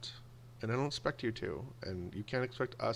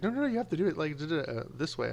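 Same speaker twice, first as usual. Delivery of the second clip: mock parents, squeaking much higher; hammed-up fussy parent voice, pitched way up; insistent parents; 6 semitones higher than usual. Parents correcting the kids' bunny-ear method, high mock whine.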